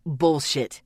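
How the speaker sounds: low, sharp retort; shot back. A narrator's reading. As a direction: fire it back. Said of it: "he shot back".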